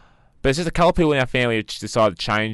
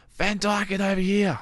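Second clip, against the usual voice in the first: calling out; 12 semitones higher than usual. Retold or imitated. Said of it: "not many people are gonna go".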